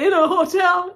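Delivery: high-pitched incredulous question; hammed-up astonished echo; retold surprise. Retold tearful surprise at hotel offer.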